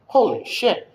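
high, loud retold alarm; shocked exclamation. Driver sees a car flying across the road, shocked. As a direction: blurt it out in shock.

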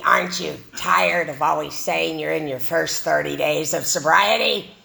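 a hint of a bitter old man yelling; mock old-timer yell. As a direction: play the cranky old-timer telling her off. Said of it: "him yelling at me".

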